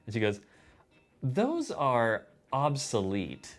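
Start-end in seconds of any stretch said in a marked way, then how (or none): 1.2-3.6 slow, over-enunciated put-down; imitating a slow, condescending enunciation. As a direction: spell out each syllable like talking down to a child. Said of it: "she enunciated it".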